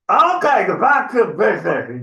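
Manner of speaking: high-pitched troll bluster; flustered troll. Acted. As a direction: take charge again as an annoyed troll. Flustered troll regains control.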